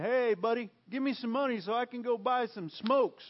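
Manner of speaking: mock a brash beggar asking for cash; brazen beggar. Imagined brazen beggar hitting Peter up for smokes, loud and pushy. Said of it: "He's not brazen in it".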